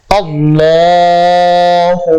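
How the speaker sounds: আল্লাহু with a thick, heavy L, slow and drawn out; heavy lam. Shown as a demonstration. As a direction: chant আল্লাহু slowly, the L full and dark. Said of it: "ল উচ্চারণ হবে".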